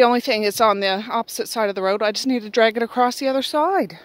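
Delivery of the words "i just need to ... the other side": usual speaking voice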